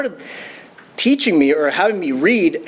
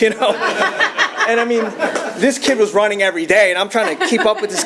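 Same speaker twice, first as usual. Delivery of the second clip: real laugh breaking into the words; 5 semitones higher than usual. Real, not acted. Laughing to the meeting about keeping up with the kid. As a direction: laugh as you carry on the story. Laughing at his reluctant agreement.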